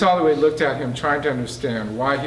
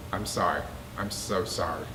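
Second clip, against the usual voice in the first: sorry apology; 6 semitones lower, 7 dB quieter than usual. A narrator's reading. Jones apologizing, grieving.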